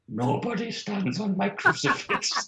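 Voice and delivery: put-on defiant nun with an Irish accent; Irish woman's accent. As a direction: pronounce it as a stern Irish woman. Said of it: "I can do the Irish woman's accent".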